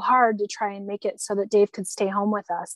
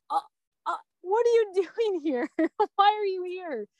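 high-pitched, halting disbelief with a nervous laugh; stunned disbelief; 10 semitones higher than usual. Retold or imitated. Widow retelling strangers arriving at her door, stunned high-pitched disbelief.

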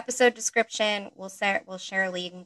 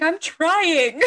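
protesting; 9 semitones higher, 9 dB louder than usual. Retold or imitated. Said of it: "I'm like".

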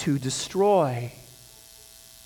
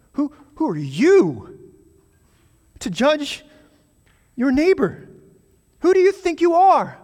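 higher, louder mocking challenge; sarcastic; 12 semitones higher, 7 dB louder than usual. James rebuking the slanderer, biting sarcasm. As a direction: throw the question back with scorn. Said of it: "you can just hear the sarcasm in James's voice".

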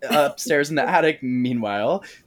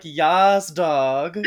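louder, higher cheer; a man cheering the dog for eating the cookies; cheering yas; 4 semitones higher, 3 dB louder than usual. Retold or imitated. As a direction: hype the dog up. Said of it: "he's like".

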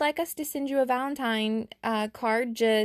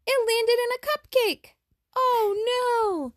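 high, bright storytelling alarm; excited; 13 semitones higher, 4 dB louder, slower than usual. A narrator's reading. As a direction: gasp it out like a shocked storyteller. Narrator exclaims at arrow chaos.

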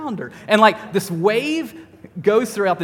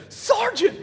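coach yelling; much slower than usual. Retold or imitated. Coach shouting a player to skins. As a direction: call him out across the court. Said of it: "he always yelled at me".

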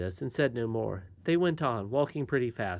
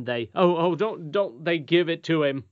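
dramatic; 4 semitones higher, 6 dB louder than usual. Acted. Boy telling goblin horror.

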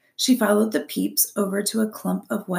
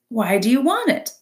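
high, sulky fox voice; a grumpy sly fox; scowling fox; 4 semitones higher, 5 dB louder than usual. A narrator's reading. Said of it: "the fox scowled".

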